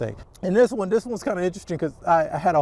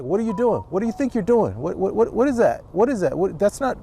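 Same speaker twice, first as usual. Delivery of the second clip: higher, stammering mock outrage; imitated scolding coach; 4 semitones higher than usual. That coach you always had to a player, mock scolding. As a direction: rattle off exasperated questions like a coach. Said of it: "you always had that coach like".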